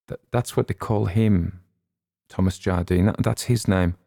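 plain reading voice

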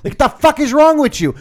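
high, loud furious shout; yelling at the debate on the car radio, high and loud. Retold or imitated. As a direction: scream it at the radio. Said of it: "I'm yelling at the fucking radio".

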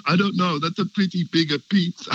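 a mock Italian plumber voice, overdone on purpose; forced Italian accent. Host retelling the sitcom plumber's line, in a mock Italian accent. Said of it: "suddenly a really forced Italian accent".

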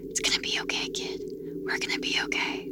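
soft unvoiced whisper. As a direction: breathe it, barely a whisper. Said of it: "who whispered".

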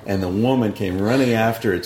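usual speaking voice

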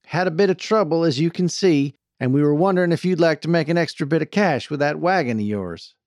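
folksy sales pitch; persuasive wagon boss. A narrator's reading. Voices Jane making her pitch.

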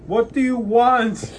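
high, slow, loud shouting; mock yell. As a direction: bellow it out high and drawn. Yelling mock demand at friends.

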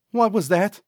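sidekick's high, rising question; high-voiced questioning. A narrator's reading. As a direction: ask it pitched well up, lifting at the end. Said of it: "Cecil asked".

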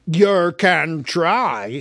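very slow, loud troll voice; a troll reaching for a skewer; menacing troll. Acted. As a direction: Bert the troll threatens with a skewer. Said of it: "said Bert".

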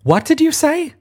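high, menacing challenge; angry demand. A narrator's reading. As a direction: dare him to repeat it. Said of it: "sudden anger in his voice".

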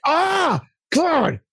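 a mock fed-up "Ah, God" aimed at the computer; frustrated outburst. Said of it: "I'll get mad at my computer and I'll be like".

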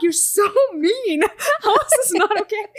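laughing protest. Retold or imitated. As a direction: whine it out through laughter. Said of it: "And I'm like".

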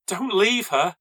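high, loud panicked plea; shriek. A narrator's reading. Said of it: "Chloe shrieked at me".

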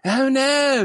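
squeaky high "oh no"; Family Guy character voice. Retold or imitated. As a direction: pitch way up for a cartoon cry. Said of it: "that character from Family Guy".

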